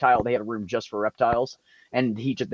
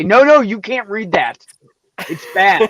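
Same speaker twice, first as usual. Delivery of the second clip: high, loud scolding; mock prudish censor; 11 semitones higher, 10 dB louder than usual. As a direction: play a scandalized book banner. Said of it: "and then say".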